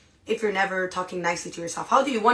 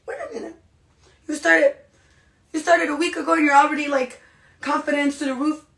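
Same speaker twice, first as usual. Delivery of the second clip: much higher, louder disbelief; mock disbelief, higher and louder; incredulous; 7 semitones higher, 7 dB louder than usual. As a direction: gasp at how fast they got confident. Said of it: "you're just like".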